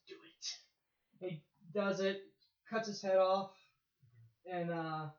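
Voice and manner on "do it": hissed quiet command; weighty commanding emperor. Retold or imitated. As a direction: whisper it like a dark lord. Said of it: "in the Emperor voice".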